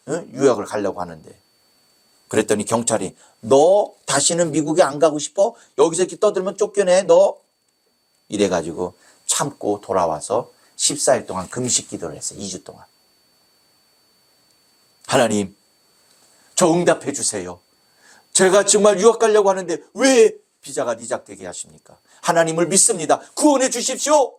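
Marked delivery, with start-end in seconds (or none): 3.4-7.4 acted scolding officer, pitch raised; high, hectoring policeman's warning; threatening policeman. Policeman threatens the protesting man. 15.1-24.3 high, urgent appeal to God; acted desperate supplication; pleading prayer. Desperate prayer after visa rejection.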